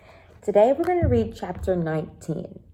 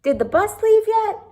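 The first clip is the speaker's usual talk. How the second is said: much higher, louder eager question; anxious Sam; 6 dB louder than usual. Acted. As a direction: ask urgently through the doorway. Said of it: "He poked his head in the door".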